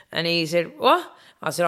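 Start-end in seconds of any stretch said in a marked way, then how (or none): 0.8-1.1 high-pitched imitated reaction of surprise; retold puzzled "what". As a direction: toss out a mock-baffled, high question. Retold puzzled reaction of Kerry man.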